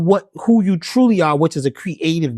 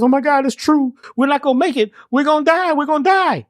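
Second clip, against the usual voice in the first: higher, panicky frog wail; panicked frog; 8 semitones higher than usual. Acted. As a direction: cry out that all is lost. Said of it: "the other frog says".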